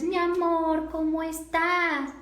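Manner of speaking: high, drawn-out cooing greeting; voice lapsing high. Acted. Greeting a relative on the phone, lapsing into high voice. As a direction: let the voice slip up high, stretching the vowels. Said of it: "se fue al tacho la conciencia auditiva".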